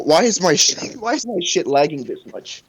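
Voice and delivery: ordinary delivery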